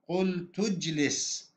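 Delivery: "قلت اجلس" run together as qultu-jlis, slowly; silent hamza. Shown as a demonstration. Teacher showing hamzat wasl, "قلت اجلس" slowly linked. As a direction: drop the hamza, linking "قلت" straight into "اجلس". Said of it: "في النطق لا نلفظ الهمزة".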